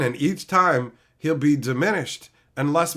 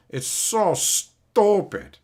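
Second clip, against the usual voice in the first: drawn-out scorn; 3 semitones higher, much slower than usual. Shown as a demonstration. Drawn-out mocking repeat.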